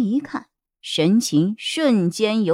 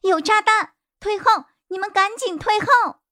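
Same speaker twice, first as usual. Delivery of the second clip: urgent shouting; 12 semitones higher than usual. Acted. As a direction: warn everyone to get back. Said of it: "急忙喊着".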